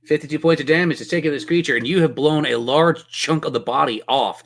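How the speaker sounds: normal talking voice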